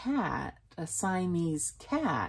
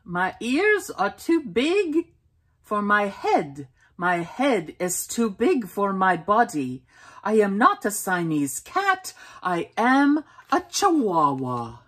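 put-on Spanish accent; 3 semitones higher, 4 dB louder than usual. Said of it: "using his very best Spanish accent".

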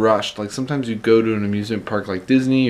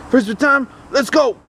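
high, bright call to the dog; rally the dog for play; excited; 12 semitones higher, 4 dB louder than usual. Real, not acted.

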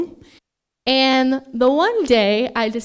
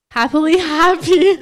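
louder, higher laugh breaking through the word; laughing at a flub; 6 semitones higher, 9 dB louder than usual. Real, not acted. Daughter flubbing her toast to mom, laughing it off. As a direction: giggle through the slip. Flubbing words in emotional speech.

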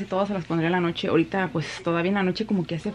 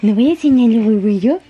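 louder teasing coo; 4 semitones higher, 8 dB louder than usual. Real, not acted. Mother teasing her baby in playful baby talk.

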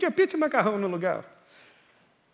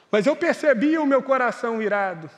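normal talking voice

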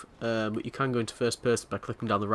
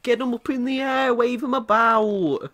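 high, slow hammed-up cheer; cheerleader voice; 10 semitones higher, 4 dB louder, slower than usual. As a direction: ham it up like a peppy cheerleader. Hammy cheerleader parody.

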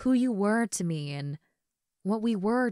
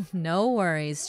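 laughing; slower than usual. Acted. Laughs before reassuring him.

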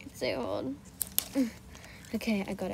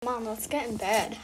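normal talking voice

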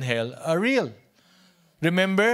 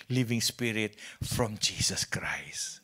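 slow whisper; 8 semitones lower, 7 dB quieter, slower than usual. Acted. Preacher whispers key phrase.

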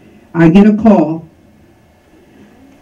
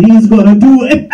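laughing; faster than usual. Real, not acted. Laughs as she finishes the punchline.

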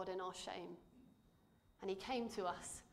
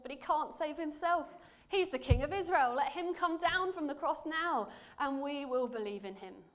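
higher, louder scornful taunt; mocking taunt; 6 semitones higher, 7 dB louder than usual. Chief priests taunting Jesus on the cross, loud scornful mockery. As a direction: sneer it out to the crowd. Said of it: "the elders mocked him".

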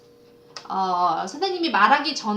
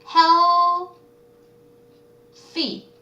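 "Healthy" split wide, "heal" held high and loud, then "thy"; syllable by syllable; 7 semitones higher, 7 dB louder than usual. Shown as a demonstration. Teacher breaking a word up for students, "healthy" syllable by syllable. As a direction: sound out "healthy" in two far-apart pieces.

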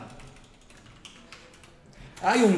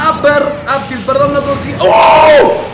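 yelling, much higher and louder; yelling across the room; 8 semitones higher, 7 dB louder, slower than usual. Real, not acted. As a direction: shout it out over the noise. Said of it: "Perdón, estoy gritando".

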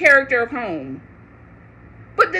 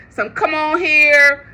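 slow mock come on; 3 dB louder, slower than usual. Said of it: "If you would have hit me".

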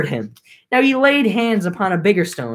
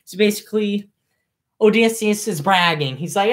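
plain reading voice

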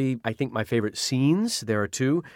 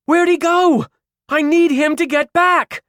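panicked shout; 7 dB louder than usual. Acted. Caro panicking for Malcolm.

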